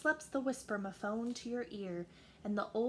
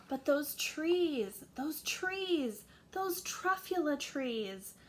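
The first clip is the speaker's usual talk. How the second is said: awed exclaim; 5 semitones higher, 4 dB louder, slower than usual. A narrator's reading. Narrator marvels at trees.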